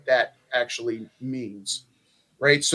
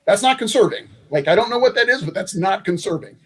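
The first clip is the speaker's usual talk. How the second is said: mock-incredulous, higher and louder; retold exasperated retort; 6 semitones higher, 4 dB louder than usual. Candidate rebutting a hardcore Republican, retold exasperation. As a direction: push back in disbelief, pitch lifted.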